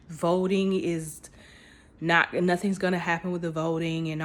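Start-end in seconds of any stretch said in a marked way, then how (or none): none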